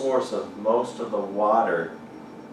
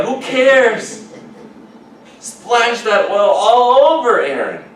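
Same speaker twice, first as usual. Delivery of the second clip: excited exclamation; 13 semitones higher, 12 dB louder, slower than usual. Real, not acted. Exclaiming at celebration.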